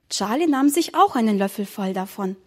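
ordinary delivery

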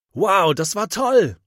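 high, slow, breathless wow; spluttering delight. A narrator's reading. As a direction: burst out thrilled after the splash. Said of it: "prustet Mathis". (Mathis prustet begeistert und hoch)

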